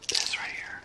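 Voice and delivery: whispered aside. Real, not acted. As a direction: hush it, barely voiced. Hushing while taking cat's ball.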